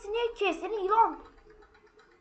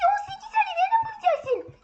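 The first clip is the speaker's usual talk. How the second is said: laughing shout; 14 semitones higher, 3 dB louder than usual. Real, not acted. Frantic hunt for the toilet.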